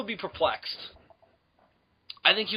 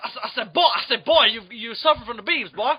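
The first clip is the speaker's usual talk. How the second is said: higher, blustering Southern rooster; Foghorn Leghorn; 4 semitones higher than usual. Acted. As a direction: stammer and boom like a cartoon rooster. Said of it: "Fucking Froghorn Leghorn".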